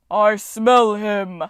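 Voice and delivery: slow, booming roar; giant roar. A narrator's reading. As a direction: roar it like a monster. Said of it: "roared the giant".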